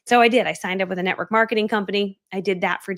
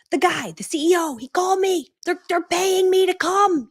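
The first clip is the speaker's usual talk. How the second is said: dramatic whine; 8 semitones higher than usual. Retold or imitated. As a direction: drag it out like a melodrama. Dramatic explanation to husband.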